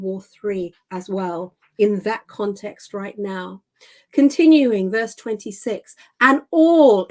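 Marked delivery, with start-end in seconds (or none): 6.2-7.1 "all" rung out higher and much louder; emphasis on all. Shown as a demonstration. Preacher stressing "all" in the verse to his congregation. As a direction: punch the word "all". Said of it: "again, emphasis there".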